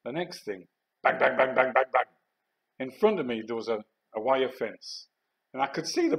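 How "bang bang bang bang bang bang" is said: loud, rapid volley of bangs; imitated gunfire. Soldier retelling machine guns opening fire, imitated gunfire. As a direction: fire off even, punchy bangs.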